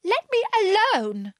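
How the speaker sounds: high indignant plea. A narrator's reading. Alice being beaten by the pigeon, indignant. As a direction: push the pigeon away with a high protest. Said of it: "said Alice indignantly".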